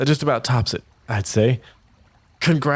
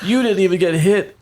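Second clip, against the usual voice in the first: high, louder indignant jab; 10 semitones higher, 4 dB louder than usual. Acted. Theo accusing Arvin, high loud indignant voice. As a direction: accuse him. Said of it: "he's like".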